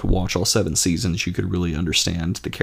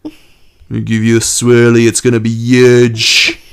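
loud, higher brash bully voice; Trump impression; 4 semitones higher, 12 dB louder, slower than usual. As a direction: bellow like a blustering bully. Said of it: "He's like".

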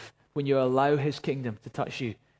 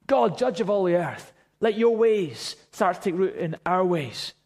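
higher, louder impassioned pleading to God; fervent prayer; 5 semitones higher, 3 dB louder than usual. Real, not acted. Preacher praying aloud for Leith and Orissa, impassioned. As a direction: cry out to God for the city.

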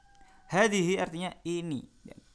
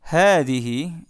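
"hadhihi" diucapkan jauh lebih keras; penekanan keras; 2 semitones higher, 12 dB louder, much slower than usual. Shown as a demonstration. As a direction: tekankan kata hadhihi dengan lantang. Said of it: "Yang pertama adalah". (guru menekankan kata baru dengan keras)

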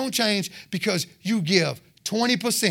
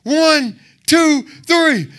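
loud, high countdown; shouted cue to roar; rousing count-in; 5 semitones higher, 5 dB louder, much slower than usual. Real, not acted. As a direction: count the crowd in at full voice.

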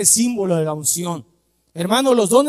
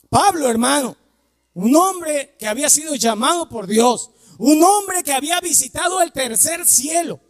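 higher and louder, shouting toward the climax; preaching at full force; 7 semitones higher, 3 dB louder than usual. Acted. Preacher exalting Paul to his congregation, shouting fervently. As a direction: build the sermon into a fiery shout.

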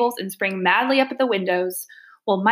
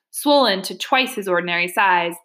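plain reading voice

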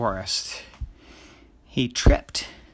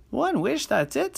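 high, disbelieving squeak; high-pitched indignant boy; surprised. Acted. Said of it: "said Jerry".

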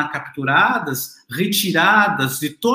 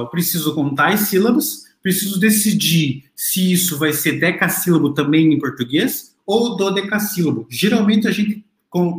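normal talking voice

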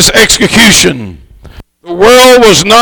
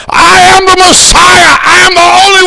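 hollered proclamation; 11 semitones higher than usual. Acted. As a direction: holler it to the whole world. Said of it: "he exclaimed and cried and hollered".

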